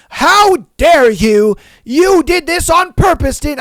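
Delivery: mock outraged shout, high and loud; irate customer. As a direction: imitate an indignant customer yelling an accusation. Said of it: "the customer would be like".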